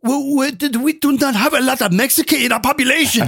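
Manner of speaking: high, stammered mock Chinese accent; imitated Chinese accent. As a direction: protest in a put-on Chinese accent. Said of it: "they come back and they say".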